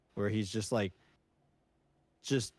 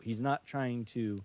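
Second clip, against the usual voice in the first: normal talking voice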